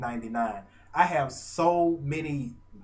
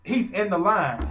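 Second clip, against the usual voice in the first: higher and louder hurried protest; flustered reply; 7 semitones higher, 6 dB louder than usual. Retold or imitated. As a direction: blurt it out, caught off guard. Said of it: "I said".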